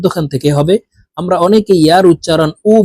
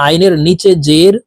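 ordinary talk

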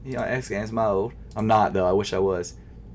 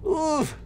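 pained high yelp; 4 dB quieter than usual. Real, not acted.

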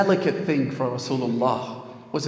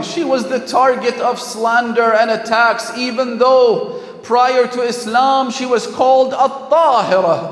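loud, raised sermon voice; forceful preaching; 6 semitones higher, 6 dB louder than usual. Acted. Preacher defending Khadija's honour to the congregation, forceful preaching. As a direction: preach it with full conviction, stretching key phrases.

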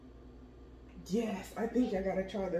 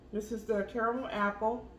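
normal talking voice

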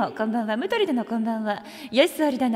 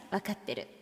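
ordinary talk

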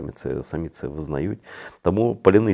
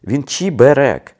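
"чеберек" drawn out, higher and louder, with a final fall; insisting on cheberek; 8 semitones higher, 7 dB louder, slower than usual. Shown as a demonstration. Vlogger insisting on the Crimean dish name, emphatic and slow. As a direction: lean hard on "чеберек", slowly. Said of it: "це має бути чебереком".

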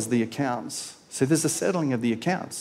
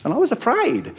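whiny servant; 8 semitones higher than usual. Retold or imitated. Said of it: "And then".

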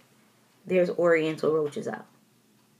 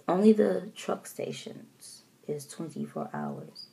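calm mom; 5 dB quieter than usual. Retold or imitated. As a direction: answer softly and reasonably. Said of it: "my mom doesn't yell".

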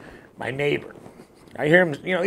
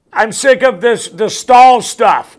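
loud, high-pitched complaining; worked-up comic tirade; fed-up rant; 6 semitones higher, 9 dB louder than usual. Acted.

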